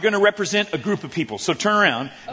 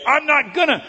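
stubborn refusal; 10 semitones higher, 4 dB louder than usual. Retold or imitated. Acting stubborn refusal to God.

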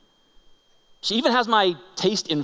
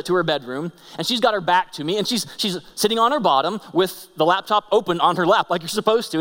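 usual speaking voice

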